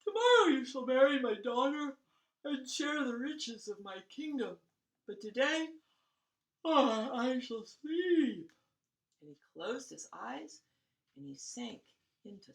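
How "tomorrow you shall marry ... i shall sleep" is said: sleepy delight. Acted. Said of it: "in sleepy delight".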